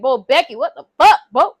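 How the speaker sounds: loud mock-angry exclamation; retold pissed-off outburst. Man angry at girl after unwanted kiss, loud retold outburst. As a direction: act out his anger, hitting it loud. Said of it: "he was pissed".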